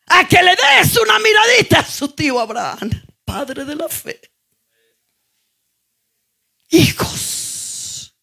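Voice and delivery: shouted high preaching, every phrase hammered out; full-force preaching. Acted. Preacher calling her church to look at Abraham, shouting. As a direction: thunder it at the congregation like a revival preacher.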